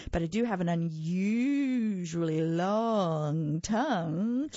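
slow, slurpy boast; slurping. Acted. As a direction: slur it through a long tongue. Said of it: "Mac slurped".

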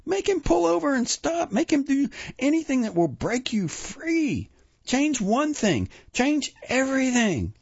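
very high, urgent shrieking. A narrator's reading. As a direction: shriek it as a desperate urging, voice way up. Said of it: "she shrieked".

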